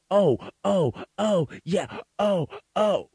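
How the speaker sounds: high, loud mocking sighs of a woman; hammed-up huffy passive-aggressive oh's. Mimicking the woman reading the job script, with high, loud passive-aggressive sighs. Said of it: "she starts to get passive aggressive".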